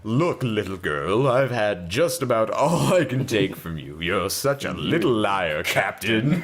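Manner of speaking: stern grocer. Acted. Grocer scolds the girl.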